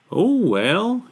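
high, resigned little Moose; Moose's resigned voice. A narrator's reading. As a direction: sigh of acceptance in a high character voice. Despairing Moose consoles himself.

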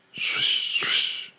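unvoiced whooshing wingbeats; whispered swish. A narrator's reading. Narrator imitating the departing horse's wings, whispered swish.

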